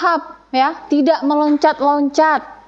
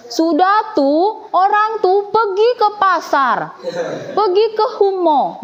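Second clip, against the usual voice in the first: Jambi dialect; 6 semitones higher than usual. Shown as a demonstration. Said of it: "Boleh tidak menggunakan bahasa Jambi?".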